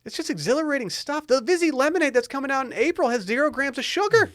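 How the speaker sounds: very high, gushing ad pitch; hammed-up excitement. Host selling the new Vizzy lemonade, hammed-up excitement. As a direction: oversell it high up, as if thrilled.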